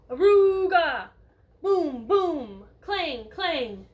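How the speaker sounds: booming Aruga, boom, clang called out loud; loud noise sounds. Acted. Teacher reading to kids, booming the mystery noise. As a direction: belt out the noises like a horn and drum.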